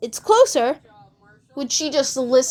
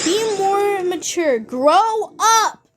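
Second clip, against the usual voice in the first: high, drawn-out mock rebuke; mock scolding; 5 semitones higher, much slower than usual. Quoting players who told a child to grow up, mock scolding. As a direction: stretch it out high, mocking the scolders. Mockingly reading others' rebuke.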